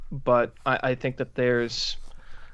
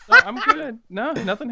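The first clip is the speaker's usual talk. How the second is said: high, laughing it off, much louder; laughing reassurance; 14 semitones higher, 7 dB louder, faster than usual. Real, not acted. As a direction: laugh through a quick no, I'm fine. Laughing off the scare.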